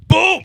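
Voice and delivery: sudden loud, high-pitched boom; imitated crash boom. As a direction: burst out with the impact noise. Acts out the truck's impact.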